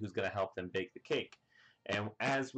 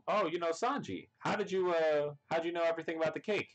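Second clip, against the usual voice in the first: pitched up, quick eager question; high gushing; 8 semitones higher, faster than usual. Retold or imitated. The chefs quizzing Sanji about the cake, high and quick. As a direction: gush with fast, high curiosity. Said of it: "they go".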